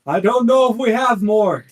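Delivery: loud, high shout over a storm; captain yelling. Acted. Captain calls to Miss Opal through the storm, yelling. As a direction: yell it out to a crewmate. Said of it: "yells out".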